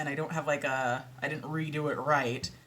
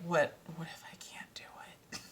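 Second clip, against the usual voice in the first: worried near-whisper; 8 dB quieter than usual. Retold or imitated. Voicing her own self-doubt.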